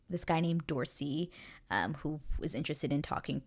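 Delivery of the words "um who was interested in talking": ordinary delivery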